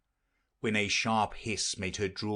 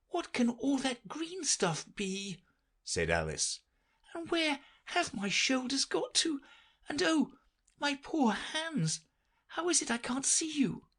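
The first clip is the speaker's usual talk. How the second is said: put-on high, bewildered little girl; Alice's voice. Alice looking for her shoulders, high puzzled girl voice.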